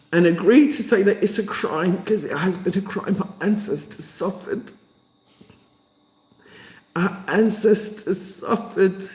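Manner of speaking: high, tearful, impassioned voice; overcome, pitch climbing as the feeling builds; crying. Real, not acted. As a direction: grieve for the ancestors through real tears. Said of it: "Can't stop crying".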